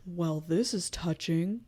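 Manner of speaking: slow, low, sinister purr; a hint of a creepy villain. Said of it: "creepy voice ... he's like".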